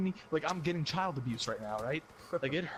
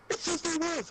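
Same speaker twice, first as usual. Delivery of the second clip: much higher interrogating mother; high mock mom voice. Mom grilling son about alcohol, high mock voice. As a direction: needle him like a suspicious mom.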